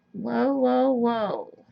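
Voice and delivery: startled whoa, trailing down; surprised whoa. Real, not acted. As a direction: caught off guard, falling whoas. Bottle squirts out too much.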